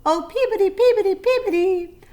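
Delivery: high, loud birdsong call; sparrow's call. A narrator's reading. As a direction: sing it out like a sparrow.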